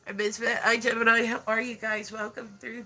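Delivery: usual speaking voice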